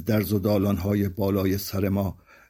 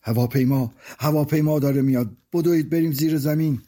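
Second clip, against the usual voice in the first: higher-pitched warning cry; urgent alarm; 5 semitones higher than usual. Acted. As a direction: sound the alarm as a scared child. Child warns of approaching plane.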